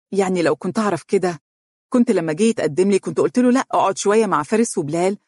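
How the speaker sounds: ordinary delivery, nothing marked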